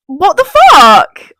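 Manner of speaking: loud, high, drawn-out disbelief; exasperated outburst. Real, not acted. Viewer reacting to Kaz calling Sammy immature, exasperated outburst. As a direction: burst out slowly, utterly exasperated.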